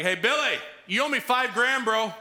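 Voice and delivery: high, loud brash bro voice; pushy demand. Retold or imitated. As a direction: shake him down casually. Servant demands debt.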